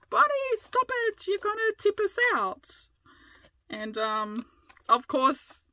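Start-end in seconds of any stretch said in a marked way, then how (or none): 0.1-2.9 squeaky high-pitched imitation of husband pleading; high mock panic. Imitating husband begging her to stop rocking, high squeaky whine. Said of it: "And he's like".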